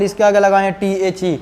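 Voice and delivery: normal talking voice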